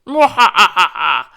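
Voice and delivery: loud slow evil laugh; evil villain cackle. Pretend. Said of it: "plays out like this".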